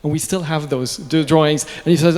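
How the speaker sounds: usual speaking voice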